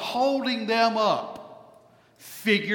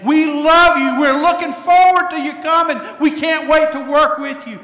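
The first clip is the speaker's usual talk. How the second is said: much higher, much louder, ringing welcome; joyful shout; 10 semitones higher, 13 dB louder than usual. Acted. Congregation calling their new pastor, shouting welcome. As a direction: shout it with open-armed joy. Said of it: "Call him and say".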